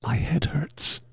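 whispered, barely voiced; soft, airy whisper of a sick man; said softly. A narrator's reading. Sick man to the actor who found him backstage, softly whispered. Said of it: "The man said softly".

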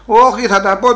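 loud, high refusal; defiant outburst. Acted. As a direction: refuse to be hushed, raise your voice. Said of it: "Μη μίλεις έτσι". (πεισματικό ξέσπασμα, δυνατά και ψηλά)

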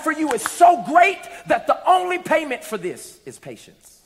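loud, high, fiery preaching. Acted. Pastor preaching patience to the congregation, fired up and loud. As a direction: lift the congregation up with full force.

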